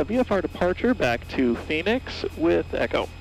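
usual speaking voice

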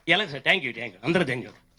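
ordinary talk

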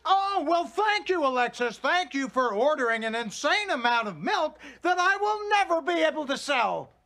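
high, heated mock gratitude; sarcastic thanks. Father thanks daughter for useless milk order, furious sarcasm.

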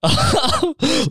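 high-pitched giggle burst; high squealing laugh. Real, not acted. Laugh alone.

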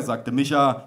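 ordinary delivery

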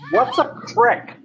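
slow, high puzzled question; imitated confused student; retold bafflement. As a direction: ask as if utterly lost.

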